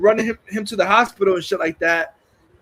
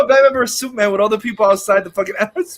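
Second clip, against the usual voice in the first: a loud, higher, half-laughing burst of outrage; outraged disbelief; 4 semitones higher, 6 dB louder than usual. Retold or imitated. Viewer reacting to the fake death, loud and half laughing. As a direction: blurt it out in mock outrage, cracking up.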